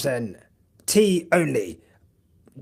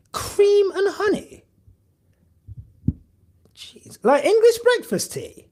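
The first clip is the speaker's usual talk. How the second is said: high-pitched incredulous squeak; can't believe what chat just said; shocked disbelief; 3 dB louder than usual. Real, not acted. Reading chat's tea order, high and shocked.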